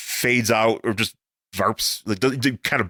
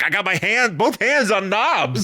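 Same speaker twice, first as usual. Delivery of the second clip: higher-pitched imitation of panicking; mock panic; 8 semitones higher than usual. Panicking guy at the controls in the background, mock panic. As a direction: play a background guy panicking, drawing out the end. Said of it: "this panicking guy in the background".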